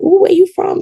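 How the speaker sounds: high-pitched, lightly mocked curiosity; imitating intrigued stranger. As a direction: imitate someone pleasantly struck by an accent. Imitates stranger intrigued by her accent.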